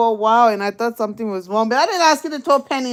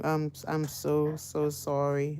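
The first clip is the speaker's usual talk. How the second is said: soft apology; 7 semitones lower, 9 dB quieter, slower than usual. Retold or imitated. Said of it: "I said".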